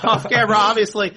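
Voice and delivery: laughing it out. Real, not acted. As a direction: repeat it through laughter. Repeats it laughing.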